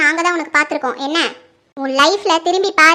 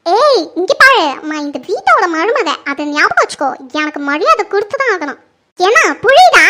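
loud, imperious telling-off; sharp demand for respect; bossy warning; 5 dB louder than usual. Acted. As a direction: put the servant in her place. Bossy daughter-in-law warns the maid.

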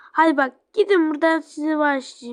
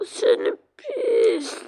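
mock whiny insult; 6 semitones higher, much slower than usual. Ragdoll insulting the other.

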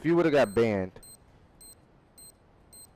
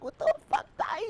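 high mock outrage. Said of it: "I'm like".

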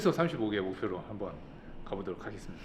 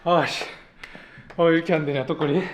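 frustrated; 5 semitones higher, 10 dB louder than usual. Real, not acted. Frustrated after failing the rep.

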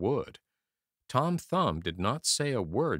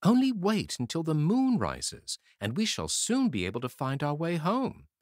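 put-on high, bright-hearted little boy comforting; encouraging Tom Thumb; 7 semitones higher, 3 dB louder than usual. A narrator's reading. Tom Thumb comforting his crying brothers, high and encouraging. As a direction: lift them up, small brave voice. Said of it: "he said encouragingly".

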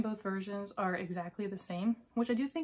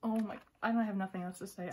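ordinary talk

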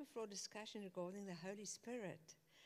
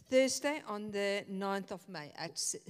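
louder, spelling out the date; repeating clearly; 9 dB louder than usual. Real, not acted. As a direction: say it louder so the room hears.

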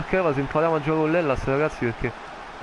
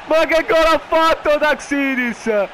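drawn-out shout; 10 semitones higher, 5 dB louder, slower than usual. Real, not acted. Repeated line, slower louder take.

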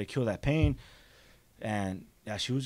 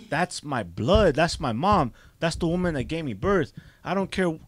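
normal talking voice

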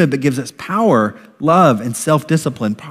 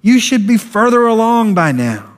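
harsh scolding; 7 semitones higher than usual. Retold or imitated. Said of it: "Instead of".

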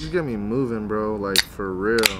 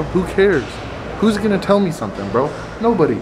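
louder, higher, fired-up bro talk; loud defiant hype; 6 semitones higher, 4 dB louder than usual. Real, not acted. Defiant hype about filming.

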